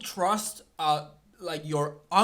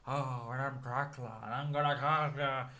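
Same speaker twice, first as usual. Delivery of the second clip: lower, softer mumble through fake fangs; Nicolas Cage Dracula; 4 semitones lower, 4 dB quieter than usual. Mocking Nicolas Cage's Dracula lines to viewers, low mumble. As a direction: mumble like a vampire with prosthetic teeth. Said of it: "he talks like this all the time".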